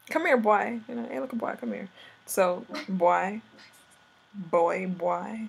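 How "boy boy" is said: normal talking voice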